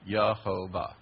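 Yehovah in three separate beats; three-syllable pronunciation. Shown as a demonstration. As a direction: count out Yehovah as ye-ho-vah. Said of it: "That's three syllables".

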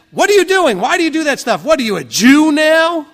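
high, loud, sneering mimicry of a heckler; mocking jeer. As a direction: taunt them like a scornful coworker. Mocking jeers at Torah keepers.

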